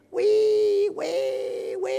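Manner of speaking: sudden high squeal, mock piglet cry. Pastor imitating the squealing piglet, high mock squeal. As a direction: squeal like a little pig. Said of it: "el cuchito va a chillar".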